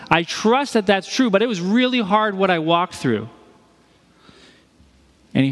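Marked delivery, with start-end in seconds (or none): 0.1-3.3 tense trust. Retold or imitated. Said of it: "sat there with that tension".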